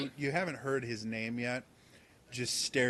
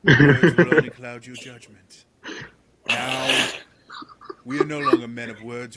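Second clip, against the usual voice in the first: much louder, commanding proclamation; booming declaration; 11 dB louder than usual. Acted. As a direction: boom it at the troops. Percival declaims.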